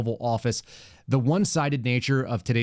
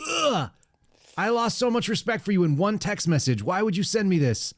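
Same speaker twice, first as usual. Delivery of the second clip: much higher, groaning mock outrage at a friend; hammed-up scolding of a buddy; mock disgust; 8 semitones higher than usual.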